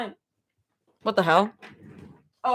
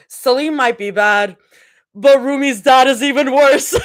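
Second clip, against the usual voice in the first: mock outrage; 3 semitones higher, 8 dB louder than usual. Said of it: "I was like".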